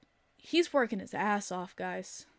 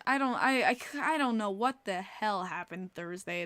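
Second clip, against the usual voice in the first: ordinary delivery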